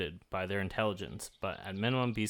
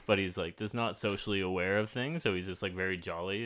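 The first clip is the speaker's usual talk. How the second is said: usual speaking voice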